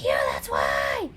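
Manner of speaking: very low, loud demonic shout; deep shouting demon. Acted. Bad conscience snapping back at the other, shouting low. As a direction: bellow it from the pit. Argument between two conscience voices.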